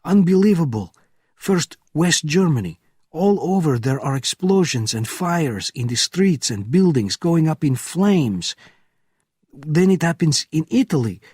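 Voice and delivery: high, loud and agitated; frantic report. Acted. Gate officer frantically telling Benjamin of the fires, high and loud. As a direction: pour out the news in a panic.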